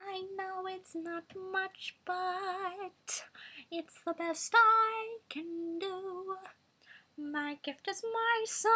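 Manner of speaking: put-on high, slow, breathy baby voice; high cutesy sung line. Shown as a demonstration. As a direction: coo it sweetly in a tiny voice. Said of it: "cute baby voice".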